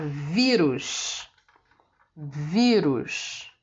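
vírus with a long, strong ví; teacher sounding out vírus, ví strongest; stress on VÍ. Shown as a demonstration. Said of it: "é a penúltima sílaba que é a tônica".

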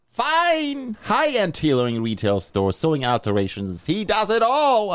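high, upbeat radio-ad pitch; sponsor ad read. Acted. Podcast host reading a sponsor ad for a tailor, upbeat ad voice. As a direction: sell it like a local radio spot.

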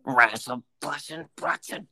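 garbled cartoon grumble; cartoonish cursing under breath. Imitating a cartoon character for a friend, cursing under breath. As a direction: grumble nonsense curses like a cartoon. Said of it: "Fucking cursing under his breath?".

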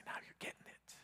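breathy whisper, barely audible; whispered answer. Acted. The Holy Spirit whispering approval to the listener. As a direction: lean in and whisper it like a secret.

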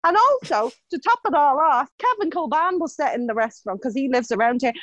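normal talking voice

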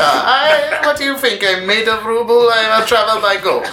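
high squeaky hammed-up Russian accent; bad Russian accent. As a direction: ham up a Russian woman, very high. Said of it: "I'm sorry for the bad Russian accents".

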